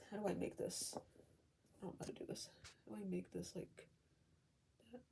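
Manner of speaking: soft muttering, dropping to a whisper; fumbling with the setup, half-whispered to herself; quiet aside to self. Real, not acted. Talking to herself while setting up.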